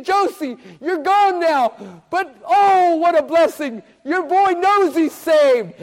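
high, loud rejoicing; exultant tribute. Retold or imitated. Preacher thinks of the late mother Josie, exultant and loud. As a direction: rejoice aloud at full preaching pitch. Joyful address to dead mother.

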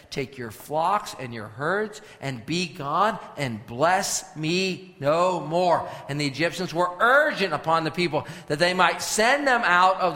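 slow proclamation swelling to loud, drawn-out cries; dramatic scripture preaching. Acted. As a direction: stretch out the quoted words, building to full force. Dramatic scripture reading, building.

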